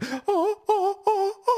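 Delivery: high excited gasp; big sharp gasp of delight. Acted. Ode to Joy moment.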